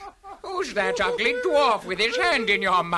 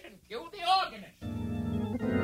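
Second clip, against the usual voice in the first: fading out; 6 semitones higher, 7 dB quieter than usual. Shown as a demonstration. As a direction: voice fading into the distance. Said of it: "fade my voice out like this".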